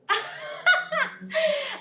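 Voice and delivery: high joyful laugh; real burst of laughter. Real, not acted. Remembering the call from her agents, joyful laughing. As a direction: laugh out in disbelief and joy. Reacting to debut news.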